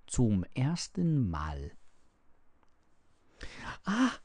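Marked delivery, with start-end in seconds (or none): 3.8-4.1 amazed little gasp of "ah"; astonished. A narrator's reading. Heinrich sees the world for the first time, astonished. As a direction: let out an exclamation of wonder. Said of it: "sagte er erstaunt".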